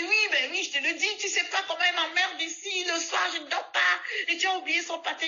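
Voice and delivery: put-on high, indignant complaining old woman; high old woman ranting; ranting old lady. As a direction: rant like a cross old lady. Old woman rants at speaker.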